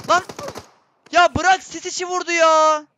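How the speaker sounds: loud, high, furious protest at a cheater; real gamer rage; angry outburst. Real, not acted. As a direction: yell it out, outraged at the unfair kill. Killed through smoke, raging.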